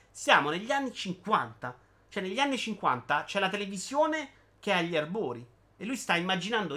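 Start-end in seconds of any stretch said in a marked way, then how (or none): none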